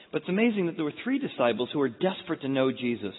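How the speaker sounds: ordinary delivery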